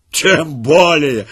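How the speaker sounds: slow, loud gloating; wild gleeful laugh. A narrator's reading. Soldier gloating savagely over the royal child, slow and loud. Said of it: "захохотал с дикой радостью".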